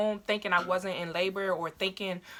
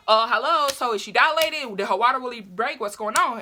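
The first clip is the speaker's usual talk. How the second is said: loud, raised mock impatience; retold demanding mom; 4 semitones higher, 7 dB louder than usual. Mom interrupts the doctor's talk, loud impatient imitation. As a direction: butt in loudly wanting answers.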